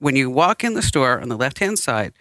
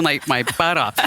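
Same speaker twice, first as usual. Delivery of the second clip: usual speaking voice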